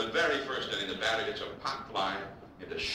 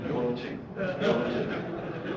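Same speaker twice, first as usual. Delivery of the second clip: rehearsing phrase; 10 semitones higher than usual. Retold or imitated. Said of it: "sitting in front of T V".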